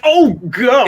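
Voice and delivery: high, loud shocked cry; sudden shocked yelp of pain; pained gasp. Acted. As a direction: yelp as the dagger goes in. Christoph reacts to the stab.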